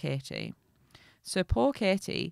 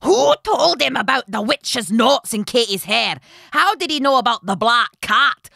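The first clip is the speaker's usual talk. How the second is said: loud, raised raging questions; furious cursing; 5 semitones higher, 10 dB louder than usual. A narrator's reading. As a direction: rant each question at full volume. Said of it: "cursing all the time".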